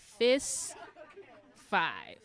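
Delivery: teacher leading a fist-to-five check; sing-song contrast. Shown as a demonstration. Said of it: "fist to five".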